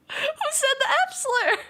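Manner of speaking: high, squeaky bewildered question; retold confusion. Streamer retells her baffled reaction to the F slur, squeaky. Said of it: "and I was like".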